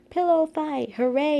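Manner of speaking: high, loud cheering yell. A narrator's reading. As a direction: cheer it like a delighted audience. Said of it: "yelled the audience".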